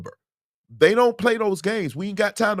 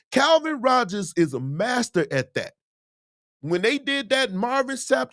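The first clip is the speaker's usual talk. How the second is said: normal talking voice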